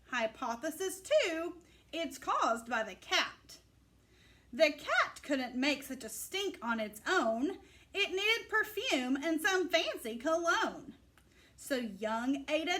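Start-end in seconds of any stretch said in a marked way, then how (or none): none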